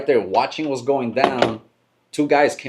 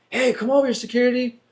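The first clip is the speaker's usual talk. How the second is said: much higher mock call for security; shouted call; 12 semitones higher than usual. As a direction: call out high like a guard being summoned.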